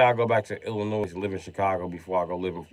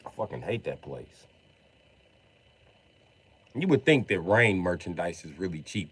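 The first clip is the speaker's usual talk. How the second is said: ordinary talk, nothing marked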